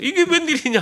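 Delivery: high-pitched, mildly startled exclamation; startled mother-in-law. Retold or imitated. Mother-in-law walks in on burnt rice, mock startled. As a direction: act out a taken-aback older relative. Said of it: "그 모습을 보더니 깜짝 놀랐어요".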